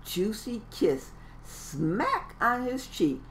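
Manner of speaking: usual speaking voice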